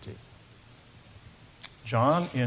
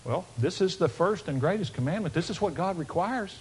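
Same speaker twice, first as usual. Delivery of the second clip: ordinary talk, nothing marked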